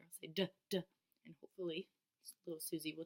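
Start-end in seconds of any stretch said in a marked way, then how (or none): 0.3-0.8 "Duh, duh" said as the bare D sound; letter sound. Shown as a demonstration. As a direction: sound out "Duh, duh" slowly for a child. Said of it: "saying the sound of the letter".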